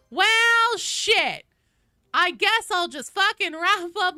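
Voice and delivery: loud, high mock-exasperated rant; playful over-the-top sweary shout-out; hammed-up cursing. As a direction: belt out swear-laden thanks, stretching the words. Nothing but cursing.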